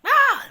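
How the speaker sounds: short, high, loud shriek; sudden piercing "Ah!" for comic effect; startled scream. Retold or imitated. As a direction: yelp in mock alarm. Startled by caller's voice on phone.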